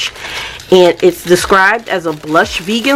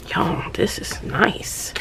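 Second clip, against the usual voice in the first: awed whisper; 12 dB quieter, slower than usual. Real, not acted. Admiring the journal.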